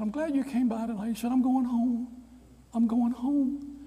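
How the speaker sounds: put-on high old professor voice; old man's voice.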